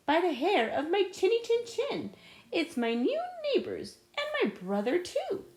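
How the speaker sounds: perky pig at the door; little pig voice. Acted. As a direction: play the second little pig brightly. Second little pig greets them.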